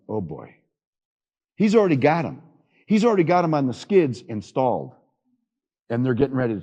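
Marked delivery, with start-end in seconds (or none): 0.1-0.6 put-on low, soft dismayed "Oh boy"; dismayed groan. Salesman realizing the pumps won't hold, soft low groan. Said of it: "And I went".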